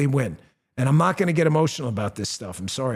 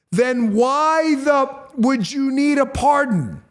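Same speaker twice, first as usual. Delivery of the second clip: outraged question; 8 semitones higher, 4 dB louder, slower than usual. Real, not acted. Outraged at pardon statement.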